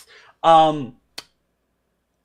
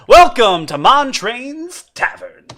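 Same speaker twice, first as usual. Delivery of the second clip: lightly voiced friendly bartender; slow tavern-keeper welcome; a hint of a jolly barkeep; 6 semitones higher, 6 dB louder, slower than usual. Bartender character greeting, slow.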